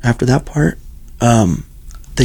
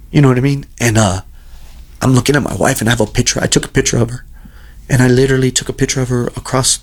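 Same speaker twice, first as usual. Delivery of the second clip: ordinary delivery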